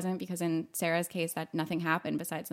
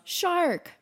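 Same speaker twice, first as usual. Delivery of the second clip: retold yell; 10 semitones higher than usual. Bully at the diving board, mock yell. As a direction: call it out high like a taunting bully. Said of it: "and she yells".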